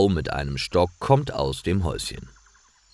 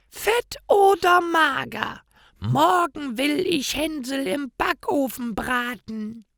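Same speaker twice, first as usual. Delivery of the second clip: wicked witch. A narrator's reading. Witch gloats that she will roast Hänsel, high and wicked. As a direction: relish the threat.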